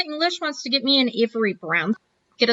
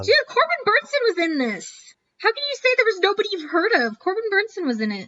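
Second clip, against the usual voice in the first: indignant protest; 9 semitones higher than usual. Real, not acted. Protesting co-host's dismissal.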